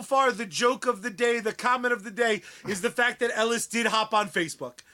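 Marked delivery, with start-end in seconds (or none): none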